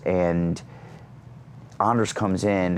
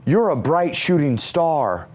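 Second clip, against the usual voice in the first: awkward compliment; 7 semitones higher, slower than usual. Retold or imitated. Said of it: "that's what I said to Danny McBride".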